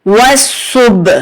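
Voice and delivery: सुब with a small bounce on the final b; slight jolt on b. Shown as a demonstration. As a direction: give the b of सुब a little jolt, slowly. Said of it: "थोड़ा सा झटका देंगे".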